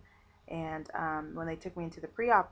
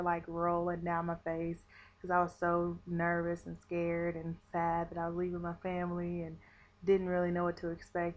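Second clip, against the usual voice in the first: usual speaking voice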